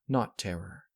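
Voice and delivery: ordinary delivery, nothing marked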